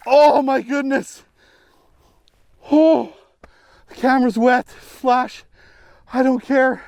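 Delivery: high, excited chatter; breathless joy over the catch; still thrilled. Real, not acted. Still holding the fish.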